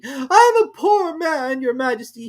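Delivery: very slow, high, loud plea; high slow begging Hatter; kneeling Hatter pleading. A narrator's reading. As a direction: beg on one knee. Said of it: "he began".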